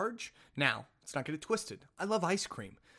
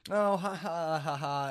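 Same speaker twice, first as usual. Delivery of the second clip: put-on laugh falling away in separate beats; imitated laugh; 2 semitones higher, 4 dB louder, slower than usual. Store worker laughing off the reply, imitated laugh. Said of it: "And then she's like".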